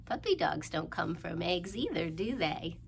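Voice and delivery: usual speaking voice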